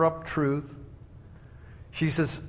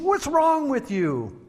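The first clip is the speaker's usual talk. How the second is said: high, slow outraged imitation; mock screaming; 12 semitones higher, slower than usual. Angry customer to the man who took his coffee, mock screaming. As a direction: draw it out in high-pitched outrage. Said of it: "this man started screaming at me".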